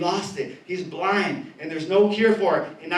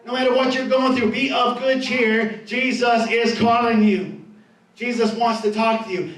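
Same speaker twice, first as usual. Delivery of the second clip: preaching appeal; 4 semitones higher than usual. Acted. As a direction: plead with the congregation to come.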